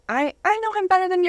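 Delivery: high, fast, indignant mock teenager; defiant teen. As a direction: snap back like a stubborn teenager. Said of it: "I was defiant".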